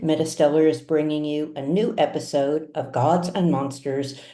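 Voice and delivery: ordinary delivery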